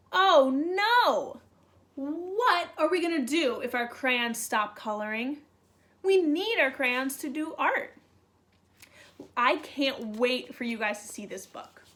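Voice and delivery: usual speaking voice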